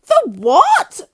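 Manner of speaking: shrieked, very high and loud; scream. A narrator's reading. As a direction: squeal it in alarm. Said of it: "screamed Missus Twit".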